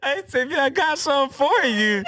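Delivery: very high, hammed-up excited line; over-excited weirdo. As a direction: deliver it sky-high like a strained bad audition. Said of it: "more excitingly".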